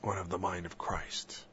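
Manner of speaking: hushed, breathy-free whisper of devotion. Real, not acted. Speaker musing on Christ, in a whisper. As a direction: whisper it like a private prayer. Quiet prayerful aside.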